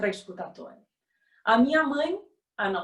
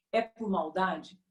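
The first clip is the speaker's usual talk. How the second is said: ordinary delivery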